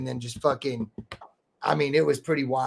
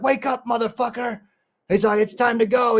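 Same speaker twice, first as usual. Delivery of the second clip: imitating a host barking him awake, pitched higher; mock wake-up yell; 7 semitones higher than usual. Host kicks the door in to wake him, mock yell.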